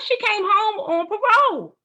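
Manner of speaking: high, loud happy announcement; excited. Retold or imitated. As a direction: deliver the good news with rising joy. Joyful reveal, then "I was happy.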